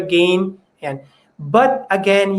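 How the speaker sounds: ordinary talk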